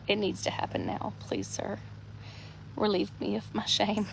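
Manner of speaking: soft, mostly breath, mortified; embarrassed whisper. Real, not acted. Hiding from the delivery man in her hammock, mortified whisper. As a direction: whisper like you want to vanish.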